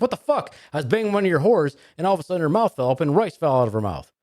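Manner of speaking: high-pitched, indignant outburst; worked-up joke character, voice pitched high; freaked-out customer. Acted. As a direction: act out a man freaking out, pitch jumping up.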